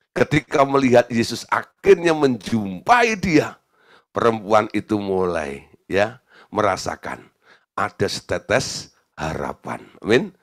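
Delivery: usual speaking voice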